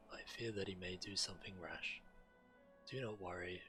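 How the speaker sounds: ordinary delivery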